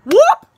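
high, loud yelp; slipping whoop. Retold or imitated. Girl slipping on the wet dock, yelping whoop. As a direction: yelp as your feet go out from under you.

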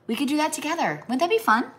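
high, bubbly pitch all through; excited invitation. Real, not acted. Inviting viewer moms to a gingerbread party, high excitement. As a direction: gush about the party idea.

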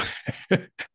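short, light chuckle. Real, not acted. Astrologer amused by his own Mars warning, light chuckle. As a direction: chuckle under your breath.

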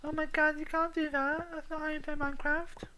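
very high, shrill-free squeaky mock voice; outraged fan. Gamer mocking an outraged Minecraft purist.

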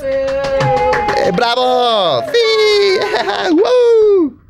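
high excited whoops; cheering. Acted. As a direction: cheer wildly with a laugh. Cheering at birthday surprise. (vitoreando con euforia)

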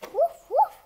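high, loud yappy woof; loud puppy bark. Acted. Puppy barks back at its owner, high loud woof. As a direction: yap it out like an eager little dog.

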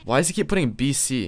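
usual speaking voice

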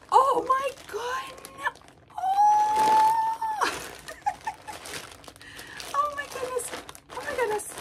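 high, loud cry of surprise; overjoyed shock. Real, not acted. Opening Gwen's box, loud high shriek of surprise. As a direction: shriek with disbelieving joy. Gasping at the gift.

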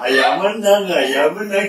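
laughing while cheering. Real, not acted. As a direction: gloat through a laugh. Father wins, laughing. (sorak sambil tertawa)

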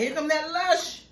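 mock bartender. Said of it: "They're gonna be like".

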